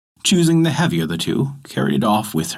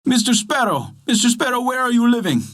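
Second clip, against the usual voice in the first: high-pitched searching call; crying out in search; 11 semitones higher than usual. A narrator's reading. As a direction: call out high for a lost pet. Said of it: "seeking for his pet and crying".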